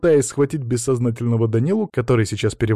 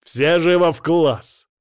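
slow, forceful shout, raised in pitch; barked order; 6 semitones higher, 4 dB louder, slower than usual. A narrator's reading. Teacher orders pupils back to class, barked. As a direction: bellow it as a command. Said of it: "гаркнул я".

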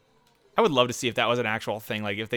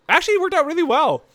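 excited; 6 dB louder than usual. Real, not acted. Surprised racing success.

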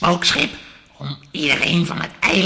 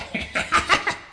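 villainous laugh. Acted. Villain governor reveals his plan.

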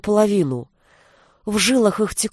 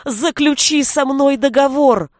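higher, urgent cry of desperation; desperate shout; 5 semitones higher, 3 dB louder than usual. A narrator's reading. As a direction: shout the demand as a last plea. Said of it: "закричал в отчаянии Милош". (отчаянный крик, выше обычного)

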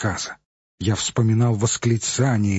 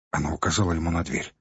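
plain reading voice

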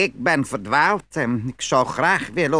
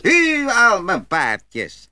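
high, louder call to the horses; 6 semitones higher, 4 dB louder, much slower than usual. A narrator's reading. Little Klaus calls out again to his horses as churchgoers pass. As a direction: sing it out proudly over the field. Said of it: "En weer riep hij".

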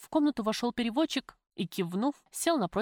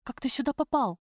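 higher-pitched amazed question; astonished; 5 semitones higher than usual. Acted. Prisoner to the lover who has suddenly come to visit, astonished. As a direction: ask in startled disbelief.

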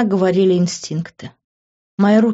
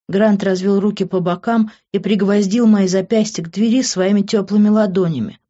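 ordinary delivery

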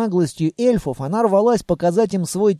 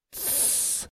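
long unvoiced "shh"; hushing shush; 18 dB quieter than usual. A narrator's reading. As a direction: hush them with a soft hiss. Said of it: "приложила палец к губам". (тихое предостерегающее шиканье)

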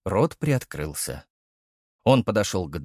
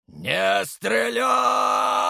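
shouting; 10 semitones higher, much slower than usual. Acted. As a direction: bark the order to hold fire. Order shouted at guards.

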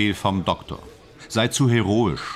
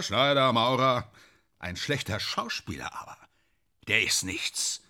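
ordinary delivery

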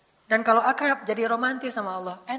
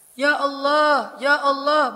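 slow, louder, forceful "Ya Allah"; firm war prayer; 4 semitones higher, 6 dB louder, much slower than usual. Shown as a demonstration. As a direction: call out with firm, pointing resolve. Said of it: "Kalau urusannya bab perang, biasanya gini". (doa perang yang tegas dan lantang)

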